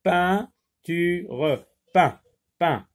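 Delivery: isolating the first syllable for the class; syllable isolated. Shown as a demonstration. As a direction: split off peint from peinture. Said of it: "la première syllabe du mot peinture".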